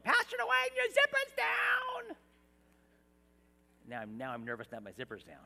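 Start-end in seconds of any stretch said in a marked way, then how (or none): 0.0-2.2 put-on much higher, slow singsong child's yell; kids yelling. Kids in class yelling to their pastor, in a high child's yell. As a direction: call it out like an eager kid. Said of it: "they would be yelling".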